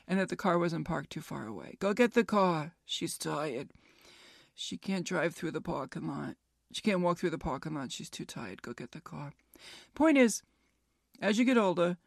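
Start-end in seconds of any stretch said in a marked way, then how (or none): 2.8-8.6 softer and lower, fading to almost nothing; hushed worn-out explanation; weary and hushed. Retold or imitated. Retold plea about exhausted mom. 8.6-9.3 much softer, lower, trailing off; quiet tired request; hushed and worn out. Retold or imitated. Retold order, fading exhausted.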